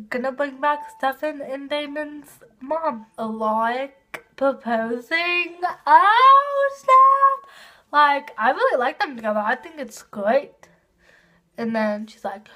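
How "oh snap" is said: much higher, delighted "Oh, snap"; high excited oh snap; thrilled squeal. Real, not acted. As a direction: squeal over the proposal. Reacting to plot twist.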